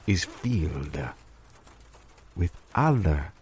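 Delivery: thick put-on French accent throughout; mock French accent. Restaurateur pitching his dream to his partner, in a mock French accent. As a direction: play a pompous French restaurateur.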